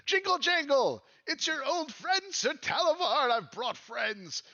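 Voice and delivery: high-pitched, pleading little character; high theatrical plea for help; Sir Talavar calling out. Acted. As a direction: call up to the house in a high, put-on voice. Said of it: "he says".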